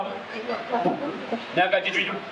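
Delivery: normal talking voice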